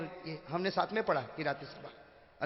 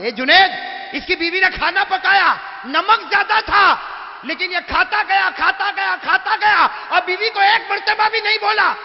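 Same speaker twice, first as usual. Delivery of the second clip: fiery preaching; 13 semitones higher, 16 dB louder than usual. Acted. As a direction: thunder it out to the crowd. Preaching at full force. (پورے جوش سے وعظ کرتا ہوا خطیب)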